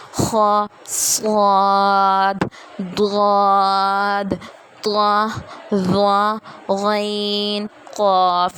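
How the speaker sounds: خا صاد ضاد drawn out full and heavy; very slow, thick, heavy sounds; heavy letters. Shown as a demonstration. Teacher demonstrating the heavy letters slowly to class. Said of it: "وہ یہ ہیں".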